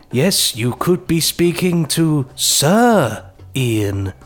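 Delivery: slow, weighty announcement, dropping on the surname; quoted drama line. Acted. Quoting a favourite line from the show, slow mock-grand delivery. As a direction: pause grandly before the name and let it fall. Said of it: "that one where he says".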